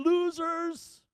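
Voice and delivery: high, gloating taunt; mocking. Family jeering at people still waiting in line. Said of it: "looking at the losers".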